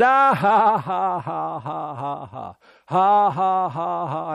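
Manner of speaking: mock belly laugh bursting out high; long run of deliberate ha-has, fading then rising; imitated laugh. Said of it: "after laughing for a while again".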